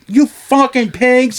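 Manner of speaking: high, slow mock yell at the cops; imitating the deaf boss yelling. Store owner storming the station, yelling imitated deaf voice. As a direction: do the boss's odd voice swearing at police. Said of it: "but in this voice".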